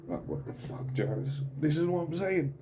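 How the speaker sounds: fighting back tears as the words crack; getting upset. Real, not acted. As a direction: voice breaking into tears, pitch jumping up. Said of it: "Don't know why I'm getting upset now".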